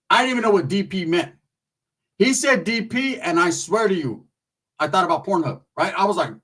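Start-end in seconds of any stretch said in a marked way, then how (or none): none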